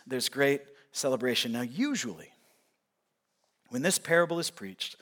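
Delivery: ordinary talk, nothing marked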